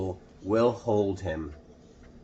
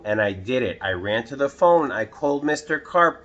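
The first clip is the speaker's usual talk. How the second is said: ordinary delivery, nothing marked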